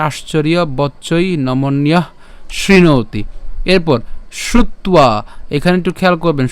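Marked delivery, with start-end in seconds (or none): 0.1-3.2 আশ্চর্য বচ্চই নমন্যঃ শৃণোতি slowly, word by word; Sanskrit recitation demo. Shown as a demonstration. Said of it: "আমার যদি উচ্চারণ করি". 4.4-5.1 শ্রুত্বা as "shrut-ua"; Sanskrit pronunciation. Shown as a demonstration. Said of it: "শ্রুত্বা কেন?".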